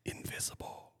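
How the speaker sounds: whispered echo, fading away; mock-dramatic whisper. Co-host making a cheesy transition, fading whisper.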